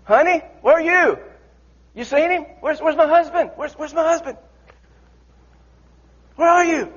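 high, calling wife's voice; wife frantically searching for husband; anxious wife. Acted. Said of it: "this wife's looking".

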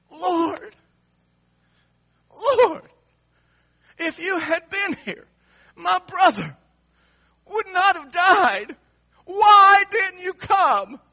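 high, full-voiced grieving wail, words drawn out; weeping, anguished plea. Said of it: "she is weeping and sobbing and mourning".

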